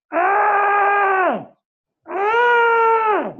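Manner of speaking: loud high scream; pillow scream. Shown as a demonstration. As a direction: let out a raw pillow scream. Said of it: "Pillow screaming".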